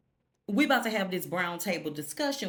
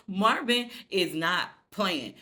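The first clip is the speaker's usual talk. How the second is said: usual speaking voice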